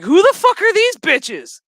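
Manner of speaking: loud, high outraged yell; furious loud cry of disbelief; outraged shout. Retold or imitated. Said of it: "And it's like".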